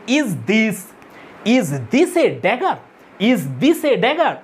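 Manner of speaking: slow scanned "Is this a dagger", beating out THIS and DAG; scansion stress. Shown as a demonstration. Teacher scanning Shakespeare for students, slow stress on "this" and "dagger". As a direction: lean hard on "this" and the first syllable of "dagger", stretched out. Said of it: "এই লাইনটা যদি আমরা scan সান করি".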